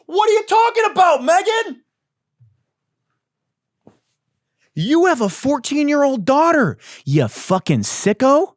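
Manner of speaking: high, loud, outraged shouting; screaming at her in disgust; furious rant. Acted. Ranting comedian yelling at Megan in outrage.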